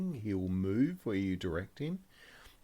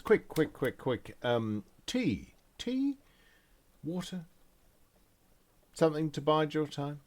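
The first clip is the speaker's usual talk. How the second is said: higher-pitched, eager host offering drinks; fussing concierge; 7 semitones higher than usual. Acted. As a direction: urge on the staff, then offer politely. Concierge hurries servants, offers drinks.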